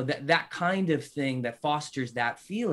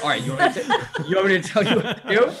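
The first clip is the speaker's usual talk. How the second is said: laughing; 8 semitones higher, 9 dB louder, faster than usual. Retold or imitated. Dad answering son, laughing.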